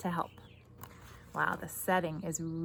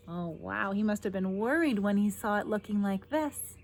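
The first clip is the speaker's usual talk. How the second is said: ordinary delivery, nothing marked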